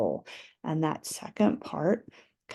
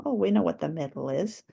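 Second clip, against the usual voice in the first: usual speaking voice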